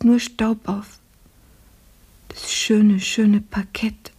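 put-on a sick girl's hushed delirium, drifting in and out; feverish delirium. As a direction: murmur as if lost in a fever vision. Fever delirium, hallucinating mistress.

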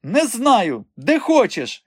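high-pitched dismissive retort; brusque, high-voiced brush-off; curt retort. A narrator's reading. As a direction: cut them off impatiently, pitch up. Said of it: "відрізала вона".

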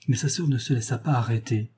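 plain reading voice

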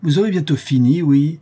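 a neighbour woman shouting at the noise; scolding woman. Acted. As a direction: tell the brawlers off sharply.

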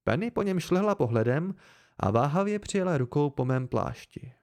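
ordinary delivery

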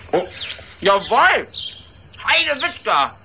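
hammed-up discovery shout; excited outburst. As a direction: burst out with a mock eureka. Sudden discovery in parody play.

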